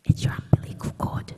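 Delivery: whispered reveal in a frail grandmother's voice. Retold or imitated. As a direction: breathe it out as a secret. Grandmother reveals the umbilical cord.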